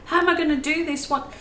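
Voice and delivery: fast, high, flustered self-quote; panicked beginner. Retold or imitated. Beginner painter mocking her old panic, fast and high. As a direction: mimic your own early panic. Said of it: "I used to feel so stressed when I very first started".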